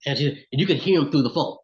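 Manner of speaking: normal talking voice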